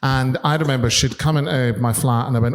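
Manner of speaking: ordinary delivery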